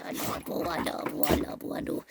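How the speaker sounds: soft unvoiced I love you; whispered love. Real, not acted. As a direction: whisper it close to her ear.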